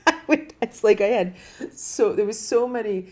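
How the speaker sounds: loud, high laugh spilling into words. Real, not acted. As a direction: crack up and keep talking. Laughing about the divorce.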